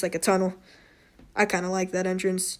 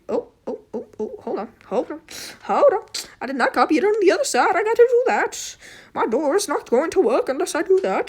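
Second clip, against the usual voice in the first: panicked; 9 semitones higher than usual. Real, not acted. Panicked realizing mistake.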